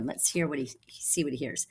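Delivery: soft whispered aside. Real, not acted. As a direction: lean in and whisper. Aside to the class.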